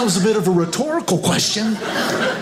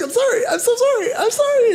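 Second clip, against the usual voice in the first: much higher, fast, teary "I'm sorry"; mock tearful apology; 11 semitones higher than usual. Imitating a crying cashier apologizing, much higher and fast. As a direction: squeak out a panicked, tearful sorry. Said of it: "This girl's in tears".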